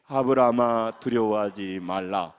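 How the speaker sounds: slow, low, solemn recitation; loud scripture reading. Real, not acted. Pastor reading God's words to Abraham with congregation, loud scripture reading. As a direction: intone the verse slowly for all to follow. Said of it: "큰 소리 읽으면 시작".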